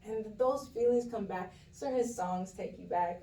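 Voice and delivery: ordinary talk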